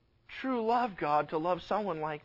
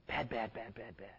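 scolding murmur; 9 semitones lower, 13 dB quieter than usual. Real, not acted. Preacher scolding the congregation, soft low tutting. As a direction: lower and much softer, fading.

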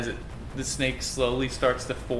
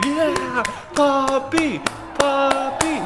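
high, loud adoring fan; cheering crowd voice; 14 semitones higher, 5 dB louder, much slower than usual. Acted. GM voicing the crowd cheering for Poppy, high and loud. Crowd chanting fairy's name.

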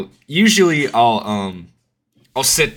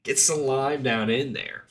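frat bro; 7 semitones higher than usual. Retold or imitated. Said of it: "I'm like".

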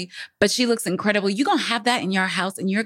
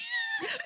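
high burst of laughter; 12 dB quieter than usual. Real, not acted. Laughs after unedited remark.

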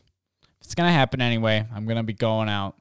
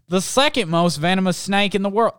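bright, raised reading-aloud voice, a touch louder; story reading; 8 semitones higher, 5 dB louder than usual. Acted. Host reading a listener's story to the audience, lively read-aloud voice.

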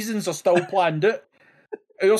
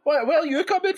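mock outrage; 11 semitones higher than usual. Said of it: "It's like".

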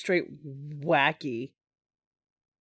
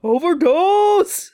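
slow loud drawn-out yell; drawn-out cheer; 8 semitones higher, 7 dB louder, much slower than usual. Real, not acted. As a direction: stretch it out like a chant. Cheering gory movie scene.

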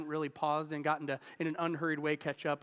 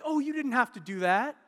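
higher, louder surprised thanks; grateful passerby; 7 semitones higher, 5 dB louder than usual. Retold or imitated. Passerby thanking the man at the mower, higher and louder. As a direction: play the touched neighbour on the sidewalk. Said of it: "And they're like".

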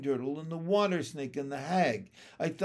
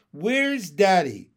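loud, slow, raised demand; insistent; 5 semitones higher, 10 dB louder, slower than usual. A narrator's reading. Froglets in chorus, insistently asking for their father. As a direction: insist like children wanting an answer. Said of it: "the insistent chorus of froglets".

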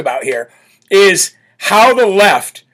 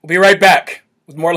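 normal talking voice

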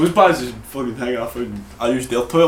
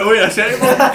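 higher and louder, half laughing; mock shocked outburst; 9 semitones higher, 6 dB louder than usual. Telling his mates how he reacted at the sink, laughing outburst. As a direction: blurt it out caught in the act.

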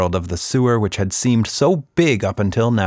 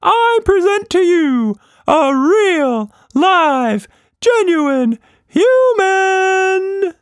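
high, loud, slow showman announcement; circus ringmaster; 4 dB louder, much slower than usual. A narrator's reading. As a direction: draw out the grand reveal.

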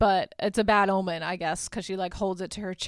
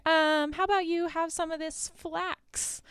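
high-pitched, put-on sweet offer; mock-innocent suggestion; coy deflection; 8 semitones higher than usual. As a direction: sidestep the advance in a high voice. She deflects his advance.

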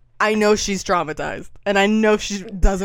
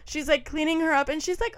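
ordinary talk

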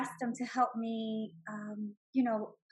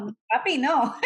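loud mock-heartbroken plea breaking into laughter; retold wail, played for laughs; 7 dB louder than usual. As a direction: beg daddy, hammed-up and laughing. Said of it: "like my heart was ripped".

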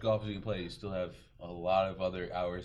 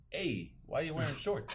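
bad Canadian accent, lightly done; imitating a Canadian reporter; 6 semitones higher than usual. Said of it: "That's the worst Canadian accent I've ever heard".

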